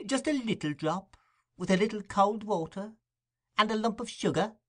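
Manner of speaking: high, sing-song coaxing; tempting hostess. A narrator's reading. Mrs Mann tempting the beadle with gin, high and sing-song. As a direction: dangle the treat.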